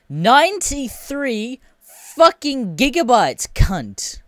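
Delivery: high, slow, drawn-out exclamation; appalled outrage. Retold or imitated. Gamer seeing the install size, appalled outrage. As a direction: stretch out the number in high-pitched disbelief.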